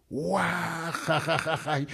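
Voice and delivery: imitating everyone bursting out laughing; mock laughter. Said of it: "llapanku asikunku".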